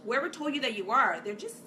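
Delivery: normal talking voice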